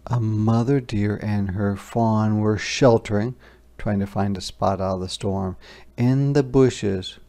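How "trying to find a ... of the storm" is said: ordinary delivery, nothing marked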